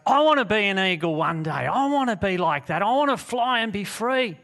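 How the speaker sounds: loud, high, excited declaration; yearning eaglet. Acted. Eaglet telling mama hen its dream, excited and yearning. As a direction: proclaim a big dream like an eager youngster.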